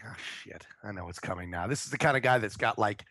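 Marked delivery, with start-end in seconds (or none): 0.0-1.7 low, very soft aside to himself; a quiet, sinking aside; muttered dread. Retold or imitated. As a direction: mutter it under your breath.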